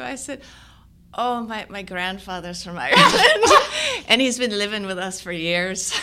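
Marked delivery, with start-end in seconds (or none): 1.1-5.9 put-on louder Irish accent broken by laughter; acted Irish lilt, amused and loud. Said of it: "We got a little out of her".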